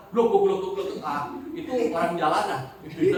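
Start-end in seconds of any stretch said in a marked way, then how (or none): 0.1-1.3 imitating someone gulping a drink, then a satisfied ah; playful gulp noises "gluk, gluk" like downing coconut water; mock gulping. Preacher mimics a crude drinker for the congregation, mock gulping. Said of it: "gaya minum air kelapa".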